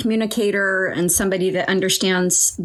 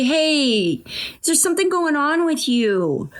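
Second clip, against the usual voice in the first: higher, kind asking voice; gentle question to a horse; 6 semitones higher than usual. Shown as a demonstration.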